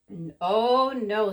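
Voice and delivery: loud exasperated no; father's refusal. A narrator's reading. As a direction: shut it down firmly.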